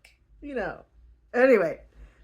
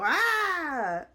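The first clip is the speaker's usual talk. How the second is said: mock yelp; 8 semitones higher than usual. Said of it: "then you go".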